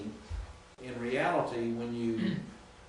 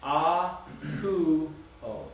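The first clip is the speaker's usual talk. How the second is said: 'A, ku, o' split into three separate syllables, first two louder and higher; 4 semitones higher, 5 dB louder, much slower than usual. Shown as a demonstration. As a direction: pause between each syllable of A, ku, o. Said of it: "Three syllables".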